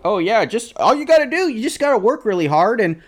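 mock the know-it-all boomer's easy advice. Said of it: "they still have that mentality where they're like".

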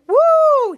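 high, loud whooping cheer; cheering woo. Retold or imitated. Acts out a character cheering.

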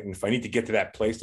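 usual speaking voice

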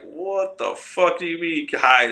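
high, drawn-out baffled protest; retold incredulous reaction; baffled disbelief. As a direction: stretch it out high, as if you can't believe it. Retelling his baffled reaction to advice.

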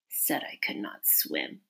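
whispered verse under the breath. Acted. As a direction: mutter it in a whisper. King muttering the verse.

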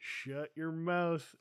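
loud, slow shut your mouth; dad telling his nine-year-old off, loud and slow; mock snap. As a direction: drag it out like a fed-up dad. Dad snaps at kid.

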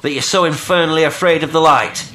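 loud, high exasperated outburst; bursting out. A narrator's reading. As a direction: burst out at the stranger. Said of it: "I burst out".

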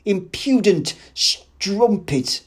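loud, high, drawn-out insults; raging, heavily stretched shout; furious shouting. A narrator's reading. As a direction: hurl slow, enraged abuse. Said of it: "he shouted".

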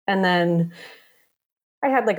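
ordinary delivery

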